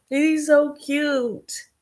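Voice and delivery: slow high drawn-out gush; overwhelmed by cuteness. Real, not acted. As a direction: draw it out, adoring. Gushing over cute cat.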